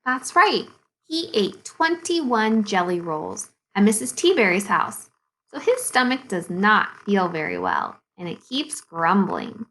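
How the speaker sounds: plain reading voice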